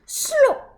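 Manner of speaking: loud, high sound effect; comic slop noise. Acted. Reader makes the hose drop loudly, comic slop. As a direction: shout the splat like a cartoon.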